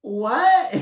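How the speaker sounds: stunned squeak of disbelief; incredulous what. Real, not acted. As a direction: yelp it in outraged disbelief. Disbelief at Tamara's behavior.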